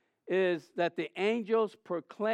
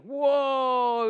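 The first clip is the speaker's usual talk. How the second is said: high, loud whoa; amazed whoa at the storm; awed whoa; 5 semitones higher, 4 dB louder than usual. Retold or imitated. Awe at lightning.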